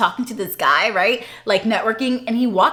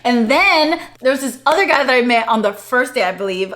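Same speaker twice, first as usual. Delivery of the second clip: normal talking voice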